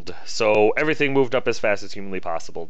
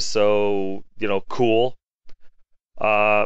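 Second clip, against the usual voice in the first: normal talking voice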